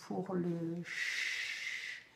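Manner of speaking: "ch" drawn out very slowly and low; long hissed "ch"; demo ch sound. Shown as a demonstration. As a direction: hiss a long "ch" at a slow pace. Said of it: "exactement la même chose que".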